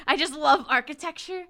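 a hammed-up swoon over buildings; gushing voice. Retelling the book, a character gushing about architecture.

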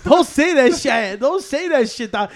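loud, sharp yell of protest; retold shout. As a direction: shout him down hard. Retold yelling at racist friend.

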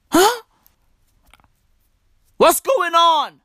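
normal talking voice

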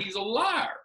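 high-pitched mock accusation; re-enacted tattling outrage; retold fussing. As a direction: pitch climbing in indignant complaint. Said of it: "chewing him and fussing him".

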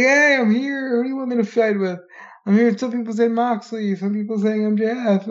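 high, excited, mock-elated wrestler voice; happy to be alive. Host mimicking CM Punk's promo to the crowd, giddily happy. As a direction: imitate a wrestler thrilled just to be here. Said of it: "he's just so happy to be alive".

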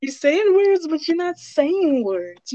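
high, slowed mocking echo. Friend mocking her friend's vague words in a high voice. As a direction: parrot her words back in a silly high voice.

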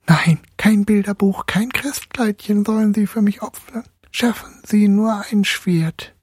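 high, effortful lisping little voice; slushy lisp. A narrator's reading. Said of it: "mühsam lispelte Nussknackerlein".

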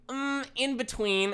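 high, slow wishy-washy answer; mock vague indecision; imitating the customer. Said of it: "The woman says".

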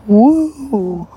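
high, loud whoop; a quick burst of cheer; excited woo. Real, not acted. Reacting to six likes.

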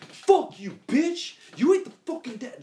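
high, loud fast yelling; furious shout. Retold or imitated. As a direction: scream it at the stepdad. Sean cursing at stepdad.

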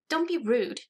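high-pitched reprimand; scolding. A narrator's reading. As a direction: tell someone off in a high voice. Alice scolds Edward after smacking him.

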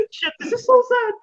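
high-pitched mock-upset voice; mock tearful. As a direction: imitate being close to tears. Acts out own tearful reaction.

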